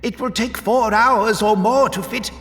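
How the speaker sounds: very high, anxious pleading voice; desperate explaining. A narrator's reading. Magric tries to explain the delay to Vorus, desperate explaining. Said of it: "Desperately, Magric tried to explain".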